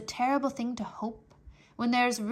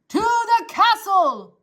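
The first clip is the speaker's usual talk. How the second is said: slow, loud higher shout; 11 semitones higher, 13 dB louder, slower than usual. Acted. Soldier shouting the destination to deaf Mig. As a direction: spell it out loudly. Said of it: "shouted the soldier".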